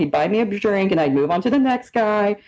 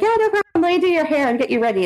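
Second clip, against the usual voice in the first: eager agreement; 7 semitones higher, faster than usual. Retold or imitated. Friend offering to do roommate's hair, eager. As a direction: agree eagerly in a high, hurried voice. Quoting herself cheering friend on.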